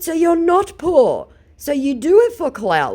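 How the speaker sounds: high, loud, slow scornful jab; sarcastic high retort; mocking taunt. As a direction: needle him with contempt. Reading her own mocking reply.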